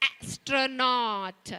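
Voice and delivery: astronaut said very slowly, leaning on the first "a"; drawn-out a. Shown as a demonstration. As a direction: stretch the "a" of astronaut. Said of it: "I'm giving that sound emphasis".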